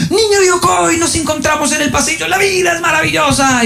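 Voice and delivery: very high-pitched, exuberant hailing; imitated gleeful shout. Teacher grabs a pupil in the hallway, high gleeful shouting. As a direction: mimic an overjoyed teacher hollering at a pupil. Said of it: "es una gritadera".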